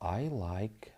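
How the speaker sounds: hushed whispered aside. Real, not acted. Trader eyeing the chart, whispering to himself. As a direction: whisper it to yourself.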